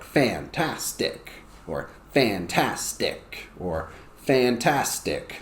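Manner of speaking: "fantastic" very slow, each syllable apart; three separate beats. Shown as a demonstration. Teacher shows variants of "fantastic" with actions, slow beats. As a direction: stretch and part the syllables of "fantastic". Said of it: "Because it has three syllables".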